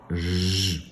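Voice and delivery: "J" as a sustained zh buzz; isolated sound. Shown as a demonstration. Teacher giving pupils the last sound, buzzed "J". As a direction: hold "J" as zh, without naming the letter. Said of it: "c'est le son".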